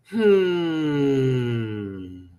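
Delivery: knowing drawn-out hmm; kids' mock hmm. Imitating the kids' smug hmm sound. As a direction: hum it like a smug kid. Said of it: "really popular with the kids".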